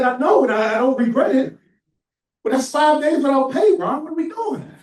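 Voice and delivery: high, loud, half-laughing protest; exasperated retort. Retold or imitated. Harry protesting five unpaid days to Ron, exasperated. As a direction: plead with mock exasperation.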